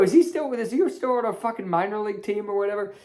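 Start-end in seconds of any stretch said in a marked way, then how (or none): none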